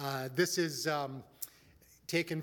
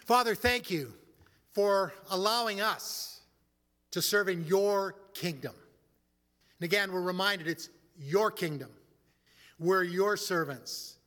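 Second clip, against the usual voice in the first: earnest prayer; 3 semitones higher, 3 dB louder than usual. Acted. Pastor leads the congregation in prayer, earnest and measured.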